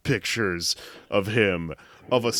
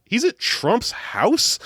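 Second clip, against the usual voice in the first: much higher, slow disbelieving question; incredulous; 12 semitones higher, slower than usual. Retold or imitated. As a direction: gasp it out in shocked disbelief. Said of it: "And they're like".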